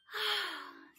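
high loud wondering sigh. Acted. Mole sighing at the star. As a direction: breathe out in awe. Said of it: "sighed Mole in wonder".

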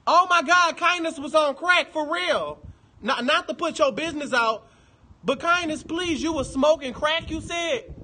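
high, loud gossipy shock; loud, incredulous call-out; scandalized outburst. Real, not acted. Scandalized at news about Kindness.

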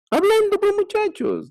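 emphatic. Real, not acted. Teacher stresses key answer.

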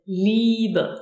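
Liebe with a long, drawn-out E. Shown as a demonstration. Teacher giving an example of German I E, long E in Liebe. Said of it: "is pronounced like a long E".

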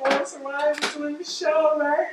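excited high-pitched gadget voice; Papi Food Processor. Pretend. Food processor excited about doing the show, high voice. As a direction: squeal it as a thrilled pretend appliance. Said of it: "He's like".

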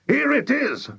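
very high, eager exclamation; excited cry. A narrator's reading. Mister Killycranky rushes into the kitchen, excited cry. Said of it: "cried Mister Killycranky".

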